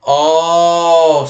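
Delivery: big drawn-out oh of understanding; sudden realization. Real, not acted. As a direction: let the penny drop noisily.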